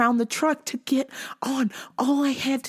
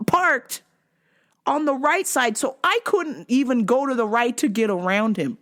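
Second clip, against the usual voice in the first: usual speaking voice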